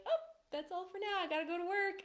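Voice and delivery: high, breezy mock tease pulling away; playful tease. Husband teasing wife before leaving, high playful mock breeziness. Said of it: "and then you're like".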